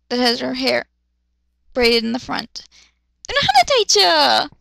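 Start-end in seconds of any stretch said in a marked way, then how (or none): 3.3-4.5 high, loud excited cry; fangirl squeal. Real, not acted. Fan recognising the captain, high and loud. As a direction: squeal at a favourite character.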